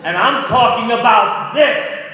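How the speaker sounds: very loud, high scream. Retold or imitated. Actor screaming at the director in front of the cast. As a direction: scream it at the top of your voice. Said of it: "He was screaming at that moment".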